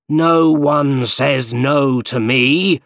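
shouting. A narrator's reading. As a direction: bellow it slowly at the hero. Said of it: "shouted the Green Goblin".

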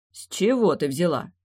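ordinary delivery, nothing marked

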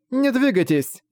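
high, urgent call to stop; urgent shout. Acted. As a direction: cry out to keep him still. Marissa urgently warns wounded man.

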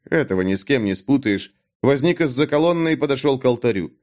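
usual speaking voice

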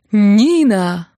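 loud, drawn-out hail; loud cheerful call. A narrator's reading. Groom hailing his bride from under the windows, loud and cheerful. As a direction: call out as if through a megaphone. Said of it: "громкий весёлый голос, усиленный мегафоном".

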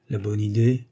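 ordinary delivery, nothing marked

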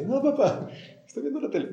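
put-on high, stubborn kid voice; a child telling dad he's watching TV; whiny child. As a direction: play the kid glued to the TV. Child refusing parent in example.

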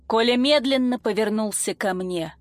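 ordinary delivery

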